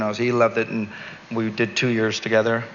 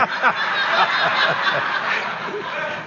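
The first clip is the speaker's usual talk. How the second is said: high breathy laugh; 6 semitones higher than usual. Real, not acted. Laughs at own joke.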